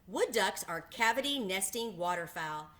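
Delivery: usual speaking voice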